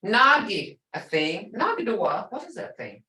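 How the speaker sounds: ordinary delivery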